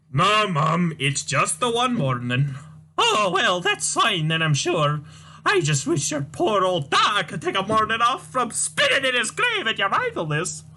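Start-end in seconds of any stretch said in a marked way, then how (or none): 3.0-10.6 put-on high, wailing, scolding old mother voice; shrill old Scottish mum. Mother wails at son Tavish, high despairing scold. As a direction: play the despairing mother crying to the Lord.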